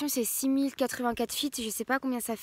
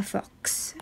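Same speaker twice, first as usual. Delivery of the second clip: whisper; 3 semitones lower, 5 dB quieter than usual. Real, not acted. Whispered name of the town.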